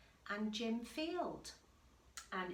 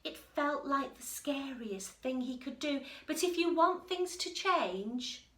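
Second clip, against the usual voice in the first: lively read-aloud voice, a little louder; story narration; 4 semitones higher, 8 dB louder than usual. A narrator's reading. Teacher reading the picture book to the class, lively storytelling. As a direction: read it to the children with bounce.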